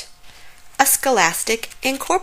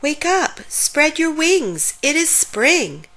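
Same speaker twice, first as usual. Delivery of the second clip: calling out; 8 semitones higher than usual. A narrator's reading. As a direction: call to a sleeper in a raised pitch. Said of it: "called to the sleeping ladybug".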